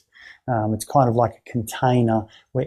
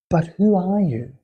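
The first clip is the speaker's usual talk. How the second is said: higher-pitched, probing question; setting answers aside; 6 semitones higher than usual. Shown as a demonstration. Therapist putting aside what the client said, probing. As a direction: gently push past the answer with a lifted, searching tone. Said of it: "like we, we put aside".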